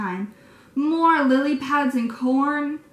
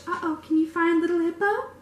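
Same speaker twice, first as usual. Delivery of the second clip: ordinary delivery, nothing marked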